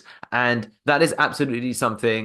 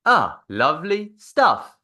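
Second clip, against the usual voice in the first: drawn-out; 5 semitones higher, slower than usual. Shown as a demonstration.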